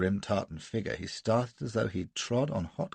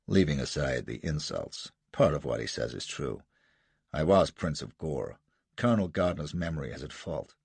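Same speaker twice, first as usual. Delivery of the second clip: lower, measured dignity; Jasser, low and composed; 5 semitones lower than usual. Acted. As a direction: answer calmly with pride.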